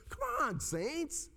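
high-pitched, exasperated urging; sudden high plea after a dull reading; rousing exhortation. Real, not acted. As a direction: jolt the congregation awake.

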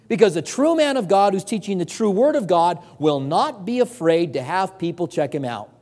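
normal talking voice

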